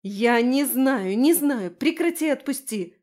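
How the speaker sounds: loud, high-pitched angry outburst; snarled retort. A narrator's reading. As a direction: snap back to make her let go. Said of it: "рыкнула я".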